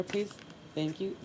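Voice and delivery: normal talking voice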